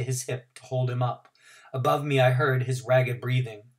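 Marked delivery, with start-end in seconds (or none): none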